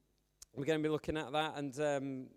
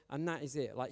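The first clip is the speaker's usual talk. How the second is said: ordinary talk, nothing marked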